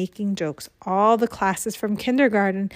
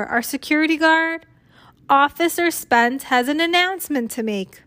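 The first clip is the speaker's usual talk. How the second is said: higher, projected introduction; school announcer; 5 semitones higher, 3 dB louder than usual. A narrator's reading. Missus Stoker introduces the security guard, school announcer. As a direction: present the next speaker to the school.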